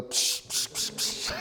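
rapid mouthed pistol shots; puffed gun noises, one after another; imitated gunshots. Husband always quick on the draw, imitated shooting. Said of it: "I was always on the".

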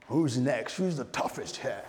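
lightly voiced cowboy challenger; gunslinger challenge. Preacher playing a cowboy facing the town, lightly acted challenge. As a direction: call out the town like a film gunslinger. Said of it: "his cowboy guns and be like".

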